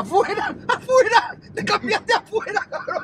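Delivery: can't believe what you just heard; astonished. Real, not acted. Host reacts to his friend changing clothes outside, incredulous.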